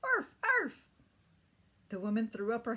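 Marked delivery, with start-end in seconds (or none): 0.0-0.8 high, loud yappy bark; playful dog bark. Acted. Ricky barks like a dog in the harness, high and loud. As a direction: bark like a puppy playing. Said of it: "began to jump about and bark".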